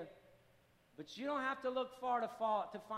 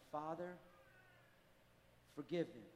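soft and low, slowed by long pauses; hushed tender quote; 7 semitones lower, 9 dB quieter than usual. Acted. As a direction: let Jesus's plea on the cross fall almost to a hush. Quoting Jesus on the cross.

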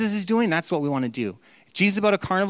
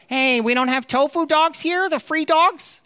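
whiny entitled visitor; 8 semitones higher than usual. Retold or imitated. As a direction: mimic a spoiled regular griping about freebies. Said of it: "coming back year after year like".

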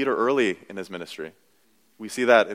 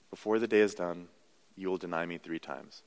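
softer, solemn voicing of Jesus's warning; hushed Jesus; 5 semitones lower, 10 dB quieter than usual. Pastor voicing Jesus to Peter, hushed and solemn. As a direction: lower the voice as if speaking gently to a friend.

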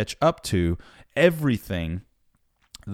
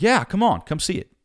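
eager invitation; 9 semitones higher than usual. Retold or imitated. Painter inviting beggar over.

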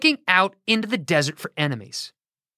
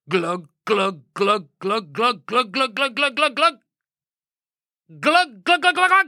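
glugging sound; 4 semitones higher than usual. Acted. Narrator voicing oil glugging onto Jehu's head, rising. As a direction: pour it out, climbing in pitch. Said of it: "It was a big bottle".

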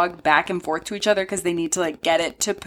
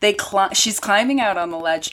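ordinary talk

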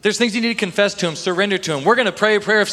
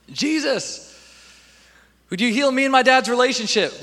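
mock whiny prayer; 5 semitones higher than usual.

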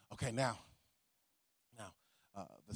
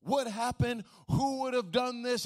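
irate outburst; 8 semitones higher than usual. Retold or imitated. David hearing Nathan's story, demanding who did this with irate outrage. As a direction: blurt it out like a furious king. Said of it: "David stands up and he's irate".

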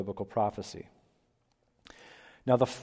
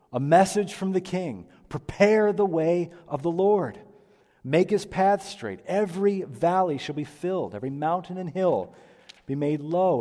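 town crier; 6 semitones higher, 6 dB louder than usual. Acted. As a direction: call it out like a herald. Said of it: "sounds like an old town crier".

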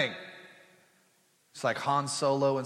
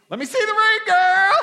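very high, loud mock-girly demand; squealing girlfriend; 12 dB louder than usual. Girls greeting the newly engaged woman, hammed-up excited squeal. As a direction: gush it like an over-excited girlfriend. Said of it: "what's the first thing they say".

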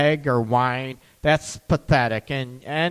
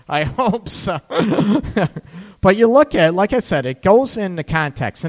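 ordinary talk

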